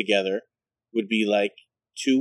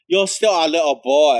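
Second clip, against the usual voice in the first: higher, louder, fond repeat; mock flamboyant boyfriend; 5 semitones higher, 5 dB louder than usual. Boyfriend repeating the line to Michael, hammed up. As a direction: coo it again, fonder and bigger. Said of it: "He says".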